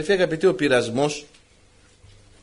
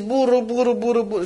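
mock grumbling noise; 5 semitones higher than usual. Preacher mimicking a nagging wife, playful grumble sounds. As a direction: imitate endless nagging chatter. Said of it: "Μη μουρμουρίζεις".

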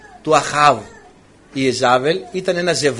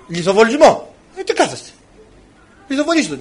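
calling for stoning; 6 semitones higher than usual. Acted. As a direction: rouse the mob to stone him. The accusers call for stoning. (φωνάζει ψηλά στο πλήθος να τον λιθοβολήσουν)

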